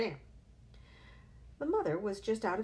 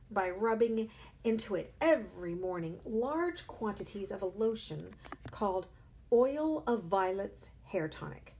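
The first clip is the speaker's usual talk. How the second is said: usual speaking voice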